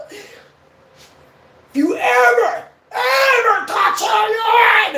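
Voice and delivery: very high, loud, drawn-out acted yelling; screamed threat. Buddy threatening the guy who scared him, furious yelling. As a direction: scream a protective threat down the phone.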